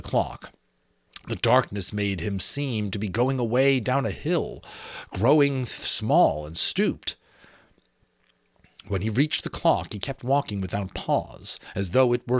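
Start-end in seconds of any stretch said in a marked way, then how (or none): none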